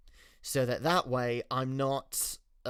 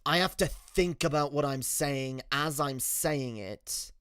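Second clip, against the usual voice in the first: light British accent, deliberately enunciated. Shown as a demonstration. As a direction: think about every word, British accent held. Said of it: "to keep this accent up".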